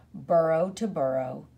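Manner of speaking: usual speaking voice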